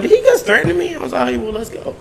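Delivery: ordinary talk, nothing marked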